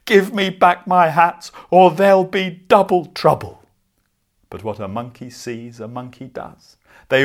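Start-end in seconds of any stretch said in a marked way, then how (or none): 0.0-3.6 much louder, higher furious yell, stamping. A narrator's reading. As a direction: roar it at them in full fury. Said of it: "The man was furious".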